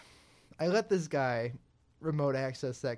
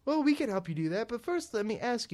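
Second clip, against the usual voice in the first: higher, overly helpful customer-service voice; imitating support agent; 9 semitones higher, 4 dB louder than usual. Retelling a Microsoft support call, mock chirpy agent voice. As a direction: sell it like a scripted helpline rep. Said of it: "He goes".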